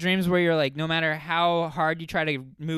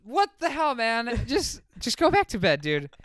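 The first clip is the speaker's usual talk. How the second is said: incredulous; 7 semitones higher than usual. Retold or imitated. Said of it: "and I was like".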